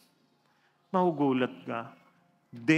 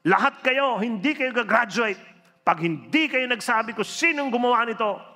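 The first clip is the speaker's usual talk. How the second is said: loud, higher threat to a whole class; threatening shout; 7 semitones higher, 5 dB louder than usual. Retold or imitated. Principal threatening students they won't graduate, loud and angry. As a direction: bark the threat at them all. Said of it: "At tinakot sila".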